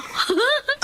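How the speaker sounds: high, scheming giggle; mean giggle; mocking laugh. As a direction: laugh at the gullible friend. Friends mocking Eileen.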